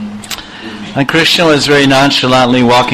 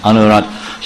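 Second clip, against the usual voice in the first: usual speaking voice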